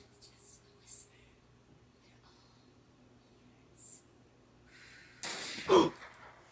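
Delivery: sharp shocked gasp; startled intake of breath. Real, not acted. Reacting to reversed creepy audio.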